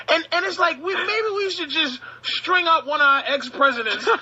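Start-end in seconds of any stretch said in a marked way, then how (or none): none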